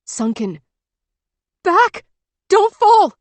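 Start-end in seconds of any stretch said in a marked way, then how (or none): 1.6-3.1 high, loud urgent yell; frantic warning shout. A narrator's reading. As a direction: warn her off the edge at full voice.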